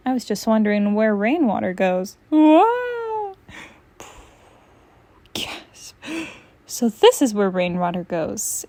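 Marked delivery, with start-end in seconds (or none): 2.3-3.3 high, loud yelp of surprise; cat yelping while sliding into the drain; startled whoa. Acted. Falling down drain. 5.3-5.9 whispered gasp. Acted. Spoken gasp.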